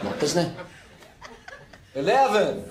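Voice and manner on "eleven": put-on high-pitched, botched American accent; American accent that lands nearer Irish; attempted American accent. Scot told he sounds Irish, bad high American accent. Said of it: "You need to try an American accent".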